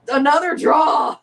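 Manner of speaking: shouting another draw at the screen; loud exasperated shout; excited shout. Real, not acted. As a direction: yell it out in disbelief. Reacting to live match.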